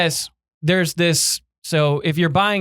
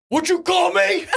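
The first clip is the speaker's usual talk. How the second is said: indignant; 12 semitones higher than usual. Retold or imitated. Retorting to hecklers.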